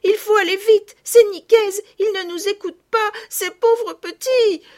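high, loud breathless rush of words; frantic. A narrator's reading. Girls bursting in to their mother, frantic and loud. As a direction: blurt it all out in a panic. Girls all talking at once.

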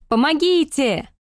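drawn-out high cry for help; shouted for help. Acted. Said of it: "Как можно громче закричала я".

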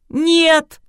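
loud, high-pitched outburst; flaring up. A narrator's reading. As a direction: cry it out in high-voiced protest. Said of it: "снова взвилась Кристина". (вспылив, громко и высоко)

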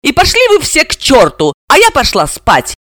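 high, cross dismissal; suddenly angry. A narrator's reading. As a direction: send them all packing. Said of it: "разозлилась вдруг Серафима".

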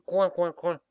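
loud high mocking quacking; sneering duck quacks. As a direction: quack like jeering ducks. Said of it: "ricanaient les canards".

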